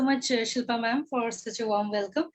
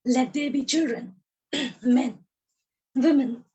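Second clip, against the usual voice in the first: measured poetic reading with long pauses; poem recitation. Acted. A poet reciting her poem to the audience, measured and deliberate. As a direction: recite each line as a refrain.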